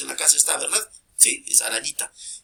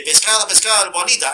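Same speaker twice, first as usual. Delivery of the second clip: louder, higher hawking cry; street fish vendor; 5 semitones higher, 7 dB louder than usual. Acted. Fish seller calling to passers-by in the street, hawking loudly. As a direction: call out fish for sale. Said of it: "ha visto por la calle, viene".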